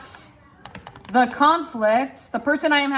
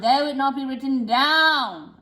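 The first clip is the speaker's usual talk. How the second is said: stretched words. Shown as a demonstration. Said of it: "stretch these words".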